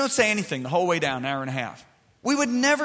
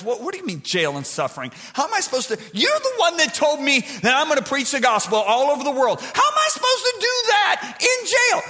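protesting Paul; 6 semitones higher than usual. Retold or imitated. Paul protesting to the Holy Spirit, rising to a shout. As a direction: argue back harder and higher until you're yelling. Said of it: "I'm sure Paul's protesting".

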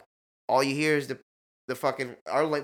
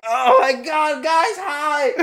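high, loud, thrilled shout of relief; overjoyed greeting; 13 semitones higher, 7 dB louder, much slower than usual. Retold or imitated. Finally reaching his lost friends, overjoyed shouting. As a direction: burst out with joy at seeing them.

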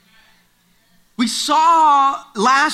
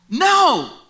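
short louder shout back; 4 semitones higher, 5 dB louder than usual. Acted. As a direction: call back to the shore. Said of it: "they shout out back".

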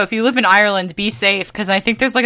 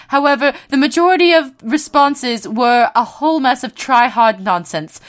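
hammed-up posh British voice; put-on British accent, lightly done; mock British accent. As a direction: read it like a posh English gent. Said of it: "if I didn't do the accent, I kept tripping".

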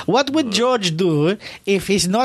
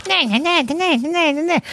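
higher mock nagging, nah-nah repeated; 12 semitones higher than usual. Mocking Ginger Kid Smith for always talking, higher teasing nah-nahs. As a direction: shoo him off like an annoying chatterbox. Mocking a rider who talks constantly.

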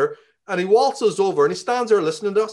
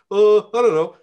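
a hesitant, louder, higher client voice; clueless client; 5 semitones higher, 8 dB louder than usual. Retold or imitated. Man telling a story voices his client's unsure answer. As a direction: play the customer who has no idea.

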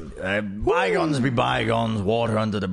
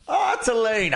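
exasperated call; 13 semitones higher, slower than usual. Acted. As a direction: scold him by calling his name. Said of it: "scolding a companion".

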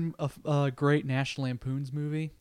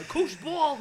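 high, bubbly gushing voice; Rosie O'Donnell impression; 12 semitones higher than usual. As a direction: sell it like a giddy talk show host. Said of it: "whenever she's like".

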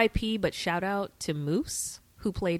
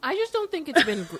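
high mock voice; 9 semitones higher than usual.

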